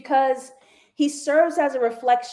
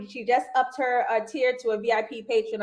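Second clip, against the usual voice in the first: normal talking voice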